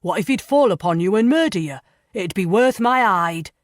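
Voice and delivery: a loud, fast, blustering jailer; loud, quick warden voice; warden's worried warning. Acted. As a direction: hurry it out like an anxious warden.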